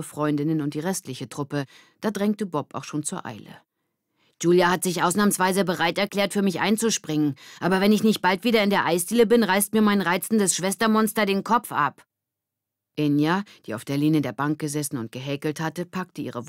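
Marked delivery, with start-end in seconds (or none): 4.4-12.1 Bob's voice, higher and louder, rushing; hurried boy. Acted. Bob urging the group to hurry, fast and loud. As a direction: push everyone to hurry.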